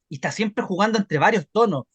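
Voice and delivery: normal talking voice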